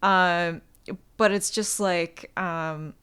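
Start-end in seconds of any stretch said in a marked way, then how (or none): none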